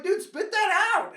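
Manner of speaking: high, loud slow yell at his friend; urging yell. Retold or imitated. Boy urging bus mate, high slow yell. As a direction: shout at him to spit it. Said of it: "and I'm like".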